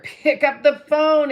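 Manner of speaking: high, loud, slow command to pick up the phone; emphatic urging. Real, not acted. Urging fellow agents to just call, loud and slow. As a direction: hammer home the advice, drawn out.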